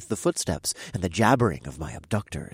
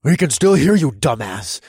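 loud, higher angry growl; 7 semitones higher, 10 dB louder than usual. Acted. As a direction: growl it down at him. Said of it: "the deep voice growled".

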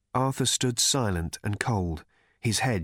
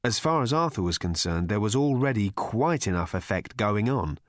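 plain reading voice

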